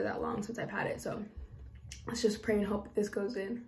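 talking while fitting piercing; fingers at lips. Real, not acted. Seen 1: Fingers hold lower lip while inserting lip piercing during words.

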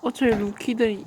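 normal talking voice

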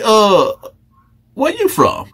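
a predator sweet-talking a new inmate; Fleece voice. Acted. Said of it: "Let me get my Fleece voice on".

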